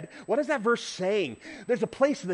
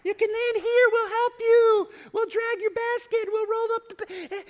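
mock helper voice; 3 dB louder than usual. Mimicking balloon crew calling.

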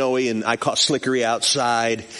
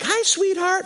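high, singsong phone greeting; imitated doting dad. Dad answering his daughter's call, mock-sweet hello.

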